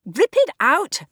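loud, high yell. Acted. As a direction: yell the order across the room. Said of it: "Bernice yelled".

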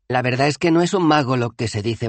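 Dos Flores, algo más agudo y confidente; voz de Dos Flores. Acted. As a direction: cuchichea el chisme sobre el mago, ligeramente agudo. (voz de Dos Flores, aguda y confidente)

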